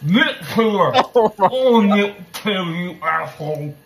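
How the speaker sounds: yelling through a mouthful of soap; loud, garbled around a soap bar. Real, not acted. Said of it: "That was a little overboard".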